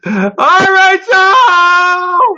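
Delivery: loud, high, excited shout of welcome. Real, not acted. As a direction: call the name out in delight. Excited welcome of returning guest.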